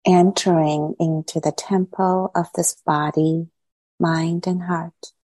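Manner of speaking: unhurried meditation guide, long silences between phrases; guided meditation. Acted. Teacher easing meditators gradually into body, mind and heart, guided meditation. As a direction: guide them into settling, phrase by phrase.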